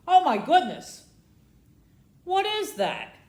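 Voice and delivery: ordinary delivery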